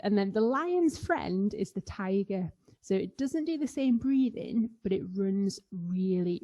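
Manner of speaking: normal talking voice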